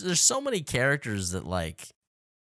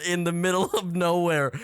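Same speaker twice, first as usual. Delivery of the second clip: mock battle alarm; 4 semitones higher, 3 dB louder than usual.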